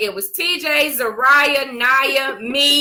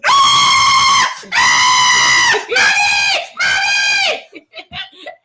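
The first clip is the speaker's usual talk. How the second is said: very high, loud burst of laughter; a long howling laugh at the memory; shrieking laugh; 6 dB louder than usual. Real, not acted. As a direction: cackle out loud, helpless with it. Big laugh at the story.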